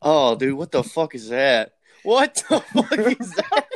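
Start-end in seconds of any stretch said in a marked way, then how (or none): 2.0-3.7 slow, high, laughing through the words; laughing disbelief. Real, not acted. Friend reacting to something awful on screen, laughing disbelief. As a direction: stretch it out high as real laughter breaks in.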